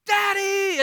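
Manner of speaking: put-on high squealed "Daddy!" of a little kid; excited little girl. Pastor imitating his little daughter greeting him, high and excited. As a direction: squeal it like a thrilled toddler. Child greeting dad at home.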